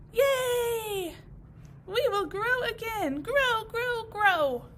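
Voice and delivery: high loud happy cheering; joyful cheer. Acted. Grasses cheering they will grow, high and happy.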